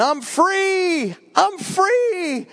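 high excited cartoon yell. Train falling off the track, yelling with glee. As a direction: shout it like a runaway toy train. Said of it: "he started yelling".

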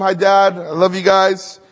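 ordinary talk, nothing marked

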